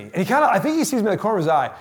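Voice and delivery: usual speaking voice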